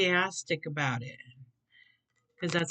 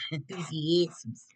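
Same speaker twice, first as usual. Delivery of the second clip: mock rat voice; slower than usual. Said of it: "almost sounds like a rat".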